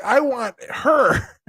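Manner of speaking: slow, drawn-out want; mock lustful I want her; lustful guy voice. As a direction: stretch it out like a hungry guy. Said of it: "guys are like".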